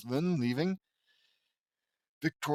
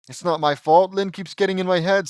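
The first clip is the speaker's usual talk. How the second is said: higher, louder whine; defensive protest; 5 semitones higher, 7 dB louder than usual. Acted. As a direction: protest that you're not to blame. Nervous Lincoln defends himself.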